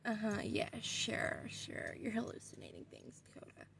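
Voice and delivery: dismissive, breathless whisper; a sister side-eyes her and whispers back; sarcastic whisper. Retold or imitated. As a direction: whisper it like a doubting little sister. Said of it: "she side-eyes me like".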